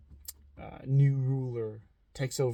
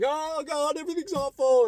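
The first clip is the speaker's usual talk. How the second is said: much higher, louder panicky wail; high loud mock-distraught cry; 6 dB louder than usual. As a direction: wail like someone losing it. Someone panicking at bad news.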